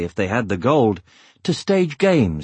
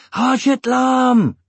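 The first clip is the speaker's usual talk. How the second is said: high, drawn-out shout; 13 semitones higher, 4 dB louder, slower than usual. A narrator's reading. Dwarf children announcing Eragon to everyone within earshot, shouting. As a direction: call it out high and long.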